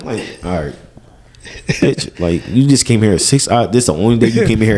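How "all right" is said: low, soft giving-in; resigned. Acted. Worker giving in to nagging coworker, resigned. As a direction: drop it low and quiet.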